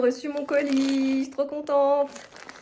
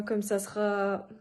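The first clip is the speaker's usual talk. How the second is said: ordinary delivery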